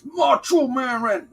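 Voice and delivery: high gruff wrestler's growl; Randy Savage impression. Joking with viewers, Macho Man wrestler voice. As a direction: announce it like a pro wrestler promo. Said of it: "Randy Savage".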